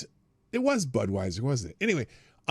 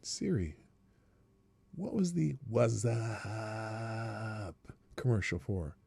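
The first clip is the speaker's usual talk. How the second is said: much softer question aside; quiet to Siri; 4 semitones lower, 8 dB quieter, much slower than usual. Real, not acted. Host quietly asking Siri about the commercial. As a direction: murmur it toward the phone.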